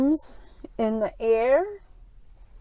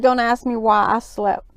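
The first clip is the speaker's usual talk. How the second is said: ordinary talk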